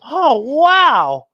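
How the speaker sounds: ordinary delivery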